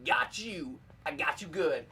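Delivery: ordinary delivery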